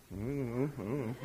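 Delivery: quiet, low shrug of an answer; a muttered, shrugging I don't know; mock unsure mumble. A pastor plays a hesitant listener, mumbling it low.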